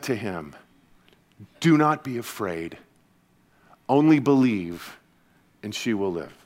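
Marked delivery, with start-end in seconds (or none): 1.6-6.3 a soft, low hint of calm reassurance; quietly steady a grieving father; gentle voice. Jesus reassuring Jairus, softly and gently. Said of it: "in a gentle voice".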